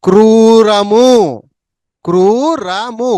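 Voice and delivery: slowly drawn-out syllables; slow, stretched word. Shown as a demonstration. Teacher stretching the long vowel for students, slowly. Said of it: "వృత్తం ఇచ్చి దీర్ఘం అనమాట".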